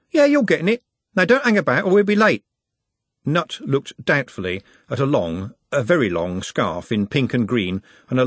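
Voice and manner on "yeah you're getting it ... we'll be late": high-pitched lad hurrying his mate along; brisk bossy Trev. A narrator's reading. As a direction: chivvy him along.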